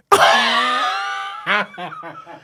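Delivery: high squeal breaking into laughter; mock holler. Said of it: "I wanna sound like my mama".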